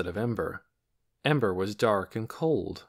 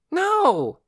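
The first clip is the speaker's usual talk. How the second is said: sharp, high, loud protest; cried out; 8 dB louder than usual. A narrator's reading. Boy objecting to losing his room, loud cry. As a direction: burst out in protest. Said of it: "cried Torin".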